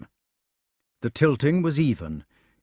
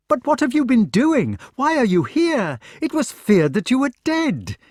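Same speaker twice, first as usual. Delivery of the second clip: much higher, louder, tumbling out; astonished eager questions; 13 semitones higher, 5 dB louder than usual. Acted. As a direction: press him with amazed questions. Knight questions found friend.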